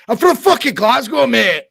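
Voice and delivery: loud, high mock-Glaswegian outburst; bad Scottish accent. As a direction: shout like an indignant Glaswegian, badly faked. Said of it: "I can't really do a Scottish accent".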